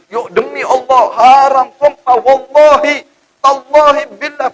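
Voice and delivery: high, loud, slow impassioned oath; swearing devil. Acted. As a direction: swear by God with fake fervour. Devil piles up oaths.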